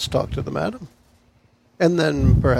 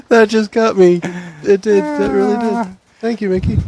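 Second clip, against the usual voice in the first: laughing; 4 semitones higher, 5 dB louder than usual. Real, not acted. Still laughing after the joke.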